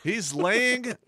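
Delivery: high, loud, laughing objection; laughing too hard to correct him calmly; laughing protest. Real, not acted. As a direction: protest through real laughter. Laughing while correcting the other.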